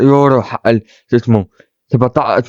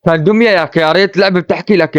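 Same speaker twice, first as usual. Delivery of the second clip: ordinary talk, nothing marked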